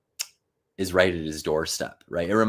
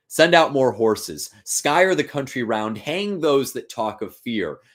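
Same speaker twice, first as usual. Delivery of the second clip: higher, louder, slow barked orders; Macbeth's commands; 6 semitones higher, 5 dB louder, slower than usual. Acted. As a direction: order them about like a desperate king. Macbeth's frantic orders read aloud.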